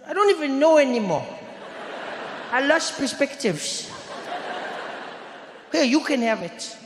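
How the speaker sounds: high-pitched, hammed-up childish despair; despairing little boy. Boy giving up his curry sandwich, high despairing voice. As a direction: pitch it up like a defeated schoolboy. Imitating the fed-up boy friend.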